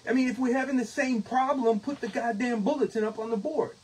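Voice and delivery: heated. Retold or imitated. Retold heated argument.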